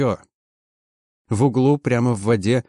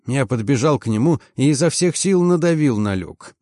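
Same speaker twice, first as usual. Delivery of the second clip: ordinary delivery, nothing marked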